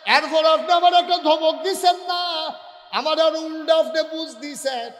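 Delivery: high, loud sustained wail of a sermon; full-force preaching. Acted. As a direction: belt it out with drawn-out line endings. Full-force melodic preaching as Ayesha. (ওয়াজের টানা সুরে উঁচু গলায় চিৎকার)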